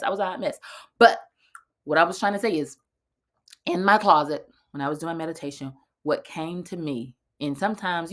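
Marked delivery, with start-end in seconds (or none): none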